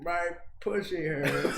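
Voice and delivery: put-on high, slow old lady voice, laughing; a granny deadpanning the shock line. Granny voice in the prank.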